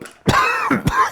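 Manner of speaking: loud, dry coughing fit; real hacking dry cough. Real, not acted. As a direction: cough hard, mid-sentence. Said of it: "I am coughing because of my dry cough".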